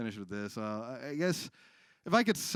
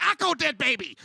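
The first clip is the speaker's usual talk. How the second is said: high, loud angry Slovak barking; angry old Slovak woman; 12 semitones higher, 8 dB louder than usual. Retold or imitated. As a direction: snap at the customer like an old shop lady. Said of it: "She's like".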